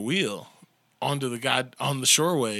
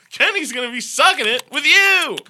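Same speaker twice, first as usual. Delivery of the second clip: much higher, louder mock come-on voice; mocking Kenny impression; 12 semitones higher, 8 dB louder than usual. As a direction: ham up a sleazy come-on.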